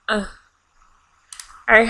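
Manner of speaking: ordinary delivery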